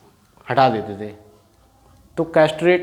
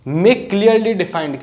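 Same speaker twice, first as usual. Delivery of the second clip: slow dictation; 4 semitones higher, 3 dB louder, much slower than usual. Shown as a demonstration. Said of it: "teacher dictating a meaning".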